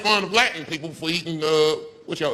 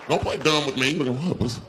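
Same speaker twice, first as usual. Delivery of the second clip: ordinary delivery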